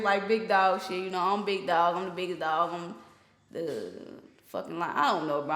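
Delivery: ordinary talk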